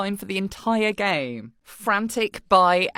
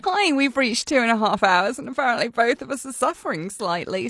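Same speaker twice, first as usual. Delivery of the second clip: normal talking voice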